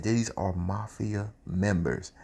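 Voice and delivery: soft, slow aside; hushed aside. Retold or imitated. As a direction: murmur it so they can't hear. Whispered aside about the Russians.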